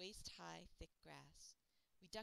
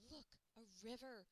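high, eager cry; excited cry; 5 semitones higher than usual. Acted. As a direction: point it out with excitement. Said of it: "Luna cried".

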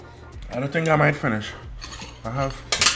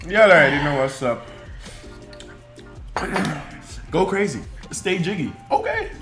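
hyped outro; 5 semitones higher than usual. Real, not acted. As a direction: celebrate the win, shout it out. Victory sign-off catchphrase.

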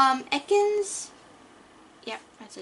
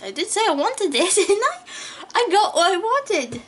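thrilled; 7 semitones higher, 6 dB louder than usual. Real, not acted. Laughs, thrilled at wanted card.

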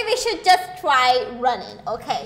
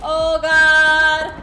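panicked shouting; 5 dB louder, slower than usual. Real, not acted. Panicked under attack.

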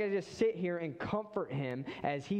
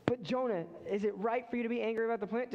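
quick higher God; 6 semitones higher, faster than usual. Retold or imitated. Mock back-and-forth God.

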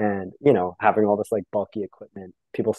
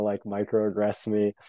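normal talking voice

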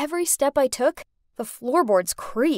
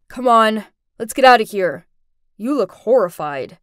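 lightly voiced guy, louder and firm; young man's urging; 2 semitones lower, 7 dB louder than usual. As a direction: urge a frightened stranger to leave. Young man urges frightened girl out.

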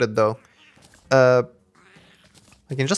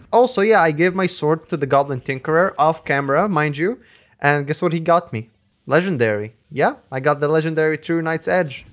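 usual speaking voice

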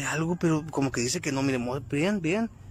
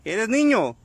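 calling to the spirit; 5 semitones higher than usual. Real, not acted. Questioning the spirit aloud.

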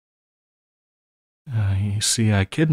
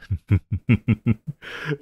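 villainous giggle; 2 semitones higher than usual. Acted. Villain gloats over her plan.

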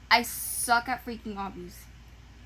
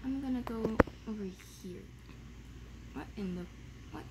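ordinary talk

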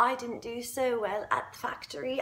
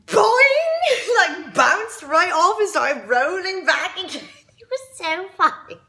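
high, loud, giggly retelling; gleeful laughing; 5 semitones higher, 6 dB louder than usual. Acted. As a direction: burst out laughing as you tell it. Laughing at the girl bouncing.

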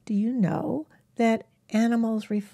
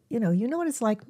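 normal talking voice

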